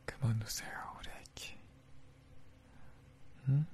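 softly fading into a hush; tender soothing. Acted. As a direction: coax her gently to stop crying. Comforting crying girlfriend.

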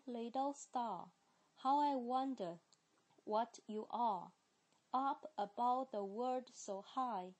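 nursery rhyme read in British English, "star" with no r; British accent, recited gently for learners; 英式发音朗读童谣. Shown as a demonstration. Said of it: "先第一遍是British".

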